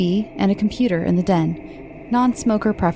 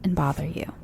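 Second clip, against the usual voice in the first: whisper; 2 semitones higher than usual. Acted. Spooky aside to listeners.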